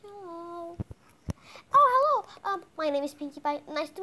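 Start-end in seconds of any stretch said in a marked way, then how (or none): 0.0-0.8 soft, timid greeting; a small, hesitant hello; scared, shy. Pretend. Scared pony greeting a stranger after mom's urging, shy. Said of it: "I'm scared, Mom". 1.7-2.5 loud, high, bursting hello; eager greeting. Pretend. Pinkie Pie greeting a shy new pony, eager.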